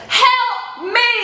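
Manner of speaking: high, drawn-out mock plea; cry for help. She pleads to the cashier for help, calling out. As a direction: call out for rescue, hammed-up and high. Appeals to cashier during struggle.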